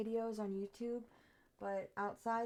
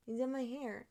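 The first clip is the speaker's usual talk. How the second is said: ordinary talk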